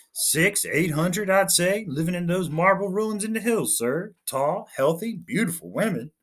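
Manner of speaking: a keen junior officer reporting brightly; eager lieutenant. Acted. Lieutenant reporting to his captain, lightly voiced eager.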